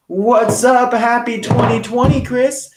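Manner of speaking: high, bright shout-out to a friend; excited greeting. Real, not acted. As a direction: greet him like a long-lost buddy. Greeting a regular live viewer.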